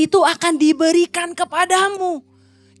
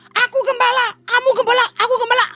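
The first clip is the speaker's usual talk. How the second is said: mock squabble; 9 semitones higher, faster than usual.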